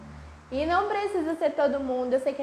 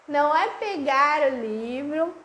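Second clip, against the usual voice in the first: normal talking voice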